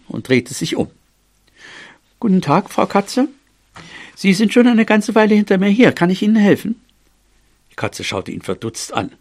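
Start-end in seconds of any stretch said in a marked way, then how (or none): 2.2-6.8 high, courteous mouse voice; eager, well-mannered squeak; polite little mouse. A narrator's reading. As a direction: offer help politely in a small, high voice. Little mouse politely addresses the cat.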